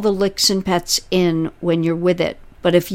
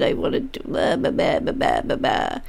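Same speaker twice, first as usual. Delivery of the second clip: breathy throwaway blah, blah, blah; whispered blah blah; 5 dB quieter than usual. Retold or imitated. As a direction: let the words fade into a whisper. Trailing mock inner monologue.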